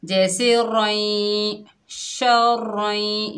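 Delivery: शर रई drawn out very slowly, nasal merge; slow recitation of शर रई; idgham with ghunna. Shown as a demonstration. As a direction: blend शर into रई with a hum. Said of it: "इदगाम माल गुन्ना होगा".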